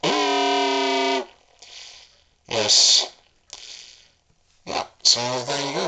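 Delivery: usual speaking voice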